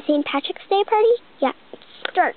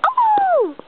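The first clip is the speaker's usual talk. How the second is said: surprised oh; 4 dB louder than usual. Pretend. Surprised doll character exclaims.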